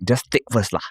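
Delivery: kiasu Singlish voice; lightly voiced kiasu Singaporean. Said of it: "Singaporeans are damn kiasu. They'll be like".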